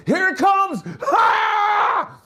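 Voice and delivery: high, loud hammed-up scream; mock workout scream. Lifter with five pounds screaming, hammed-up yell. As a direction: scream the final rep. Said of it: "Why are you screaming like that?".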